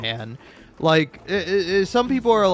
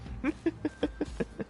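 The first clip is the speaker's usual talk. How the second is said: laugh; 11 semitones higher, 13 dB quieter than usual. Real, not acted. Amused at own rant.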